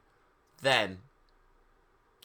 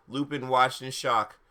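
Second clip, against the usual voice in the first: plain reading voice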